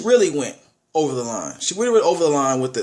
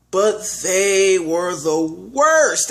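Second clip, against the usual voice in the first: slow, drawn-out "worst"; dragging out "worst" in disgust; stress on worst; 3 semitones higher, 3 dB louder, much slower than usual. Real, not acted. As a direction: lean hard on "worst" and stretch it. Disgusted movie review.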